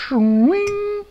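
high, playful metallic swish; slicer sound effect. Pretend. Dad playing with a cheese slicer, high swish sound effect. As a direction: voice the blade slicing through.

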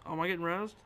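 ordinary delivery

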